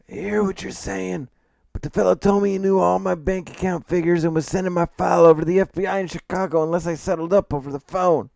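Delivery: put-on cranky old man voice, high and loud; grumpy old man. A narrator's reading. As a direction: complain like a crotchety old man. Old man McCrae complains.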